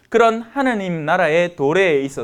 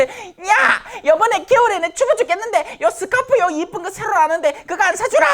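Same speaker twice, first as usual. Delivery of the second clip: high, wheedling mock demand; fussing elder; 12 semitones higher than usual. Elder demanding a scarf from the children, high mock fussing. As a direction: pester in a much higher put-on voice. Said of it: "난리를 피운 어르신".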